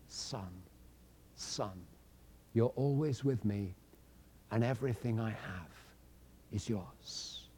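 very soft and low, gently spoken; tender father. A narrator's reading. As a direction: speak to the son tenderly, almost under the breath. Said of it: "And in his kindness".

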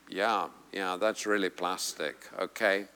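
low, soft, unimpressed reply; dismissive aside. Acted. Preacher voicing an unimpressed listener, dismissive aside. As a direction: drop it right down, barely bothered.

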